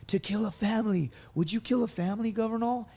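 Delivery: high, loud mock taunting of a drunk senior; drunk senior Marine. Drunk senior Marine grilling a junior during push-ups, hammed-up high taunt. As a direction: needle the junior with a mocking singsong. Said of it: "they go".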